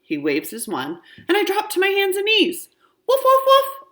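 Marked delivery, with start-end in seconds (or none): 3.1-3.9 much higher, louder yappy puppy woofs; puppy barks. Acted. Child turned puppy barks happily for Mister Nuggy. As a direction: bark like an eager little puppy. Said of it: "I bark".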